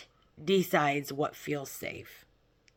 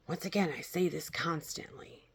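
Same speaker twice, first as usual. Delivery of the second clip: whisper; 9 dB quieter, faster than usual. Real, not acted. Quick aside whispered.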